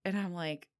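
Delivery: ordinary delivery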